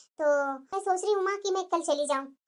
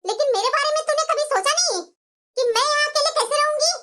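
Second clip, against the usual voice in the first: hurt complaint; 7 semitones higher than usual. Acted. Girlfriend complains she was left.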